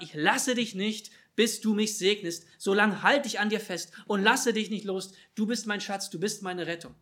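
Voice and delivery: cling on and declare it with force; insistent plea. Acted. Believer refuses to let God go, fervent insistent plea.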